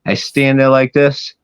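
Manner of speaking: ordinary talk, nothing marked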